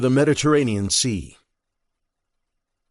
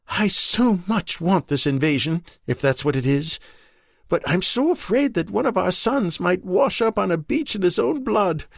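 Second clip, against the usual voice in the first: higher, pleading wife close to tears; Marion, desperate wife; 8 semitones higher than usual. Acted. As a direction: beg him for a shred of hope. Marion fears for her sons.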